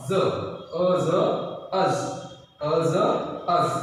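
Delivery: ज, अ ज stretched very slowly, then अज; very slow sounding out. Shown as a demonstration. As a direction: draw out each sound. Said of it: "अ और". (बहुत धीरे-धीरे अ ज जोड़कर अज)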